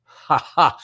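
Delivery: light laugh. Shown as a demonstration. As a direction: laugh out loud. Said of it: "I'm laughing".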